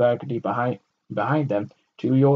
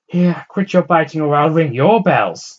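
loud, slow, high teasing threat; woman in window; 6 semitones higher, 10 dB louder than usual. A narrator's reading. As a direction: push him off with a warning. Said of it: "the woman in the window said".

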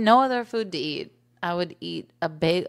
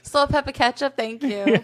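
mock New York order; 5 semitones higher, 3 dB louder than usual. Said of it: "New York bagel order".

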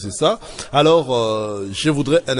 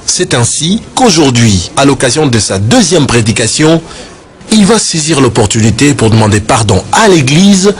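much louder documentary reporter voice; news report voiceover; 13 dB louder than usual. Acted. Reporter introduces the second sermon, in a loud voiceover.

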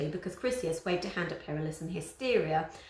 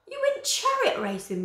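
high, loud moaning lament; moaned; 6 dB louder than usual.

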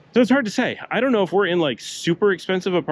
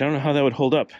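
normal talking voice

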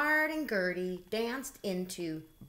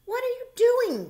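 high, loud call from another room; 9 dB louder than usual. A narrator's reading. Gertie calling to Bernard in the bathtub, loud call. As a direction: call out to a friend in the tub. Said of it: "Gertie called".

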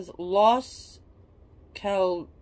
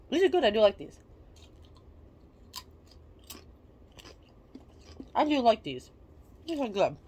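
talking with food in mouth; eating chips between words; chewing while talking; 3 semitones higher than usual. Real, not acted. Seen 0: chip in hand, raised to mouth mid-speech, talking between bites.

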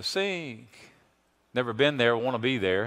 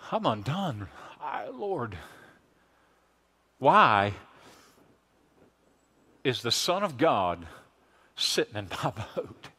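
much softer, broken, awestruck words; awed hush; 4 semitones lower, 6 dB quieter than usual. Acted. Peter overwhelmed that God sits in his boat, soft and awed. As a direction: let it fall to a stunned near-hush. Peter undone before Jesus, quietly.

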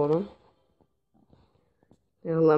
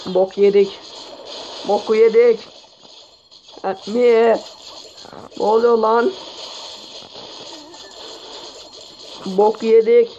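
panicked shouting; 3 semitones higher than usual. Real, not acted. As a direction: yell in real alarm as the troops die. Base getting wrecked in attack.

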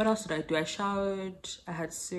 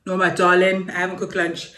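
calling out; 12 dB louder than usual. Real, not acted. Calling out to Whitney off-camera.

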